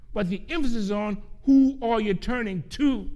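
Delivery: "who" pressed hard as the key word; stress on who. Acted. Preacher repeating his point to the congregation, stressing "who". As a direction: lean on "who" so it lands. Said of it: "Did you get that?".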